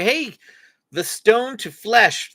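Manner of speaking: ordinary delivery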